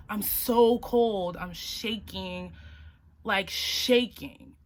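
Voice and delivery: drawn-out shivering complaint; slow, miserable. Retold or imitated. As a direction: stretch it out, freezing.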